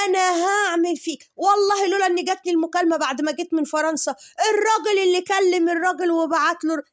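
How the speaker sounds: high, loud, hammed-up raging threat; mock furious rant. Imitating her raging at the Baron, high and loud. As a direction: shriek threats like an enraged woman. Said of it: "قعدت تقول له إيه".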